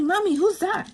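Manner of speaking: high-pitched little son voice; high, curious kid imitation; child's question. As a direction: ask mommy like a curious small child. Retells her young son asking.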